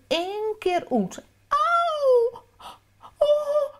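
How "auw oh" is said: high wailing ouch; crying out in pain. Acted. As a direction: wail it like a hurt little child. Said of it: "rapt Pimme".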